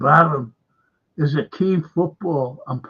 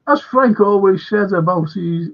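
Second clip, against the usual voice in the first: usual speaking voice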